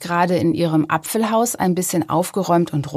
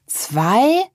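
higher, rising astonished question; surprised cry; 5 semitones higher than usual. A narrator's reading. The others reacting to the witch's finding, surprised cry. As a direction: exclaim it with a sharp upward lift. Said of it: "riefen die anderen".

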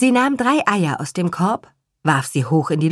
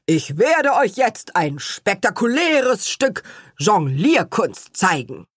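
slow, showman's proclamation; lightly voiced announcing father; grand announcement. Father announcing his juggling act, slow grand proclamation. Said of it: "verkündete Herr Kuchenbrand".

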